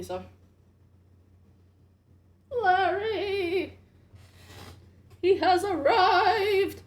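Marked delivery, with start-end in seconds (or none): 2.6-6.8 loud, high, slow proclaiming; excited announcement. Real, not acted. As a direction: hail his arrival grandly. Announcing Larry's arrival excitedly.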